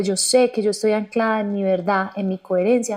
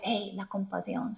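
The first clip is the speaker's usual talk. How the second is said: gentle compassion; 14 dB quieter than usual. Acted.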